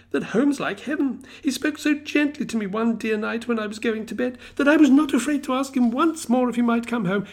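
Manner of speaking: put-on high, loud, delighted child; a little girl bursting with glee; gleeful little girl. A narrator's reading. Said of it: "brimming full of glee".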